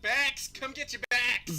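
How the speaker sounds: put-on hawker yelling in a Boston accent, "backs" said with a flat Boston a; Boston vendor calling out "Come get your backs!". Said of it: "with a Boston accent".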